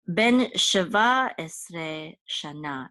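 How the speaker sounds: Ben sheva esre shana, slowly, word by word; slow modelling. Shown as a demonstration. Teacher modelling how to say an age, slow and separated.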